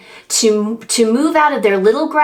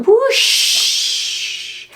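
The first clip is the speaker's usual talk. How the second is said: breathy high whoosh of wind; 14 semitones higher than usual. Acted. Reading to a child, a breathy wind whoosh. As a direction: blow it out like winter wind.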